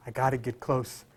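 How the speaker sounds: said inwardly. Shown as a demonstration. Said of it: "Say it".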